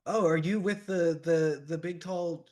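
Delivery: lightly put-on child voice, halting; child character. Game master voices the child.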